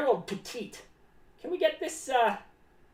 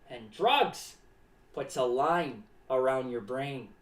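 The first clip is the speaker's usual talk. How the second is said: ominous low warning; 11 semitones lower, slower than usual. Acted. Clown warning kids about drugs, low and ominous. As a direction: drop the pitch way down on "line".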